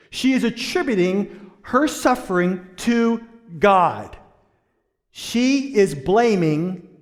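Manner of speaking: high, loud insistent preaching; emphatic preaching. Real, not acted. Pastor insisting Naomi blames God, emphatic and high. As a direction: press each word on the listeners.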